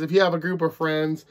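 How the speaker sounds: ordinary delivery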